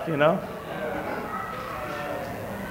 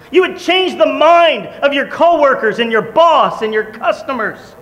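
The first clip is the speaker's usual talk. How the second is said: preaching; 6 semitones higher than usual. Acted. Preacher urging the congregation to change their world, building fervor. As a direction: preach it with growing fire.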